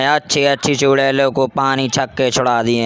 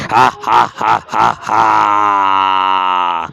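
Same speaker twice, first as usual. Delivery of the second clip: witch cackle; 4 semitones lower, much slower than usual. Acted. Witch cackles after threat.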